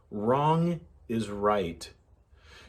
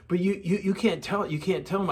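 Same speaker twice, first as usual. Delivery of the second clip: put-on girl pleading, higher; 7 semitones higher than usual. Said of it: "She said".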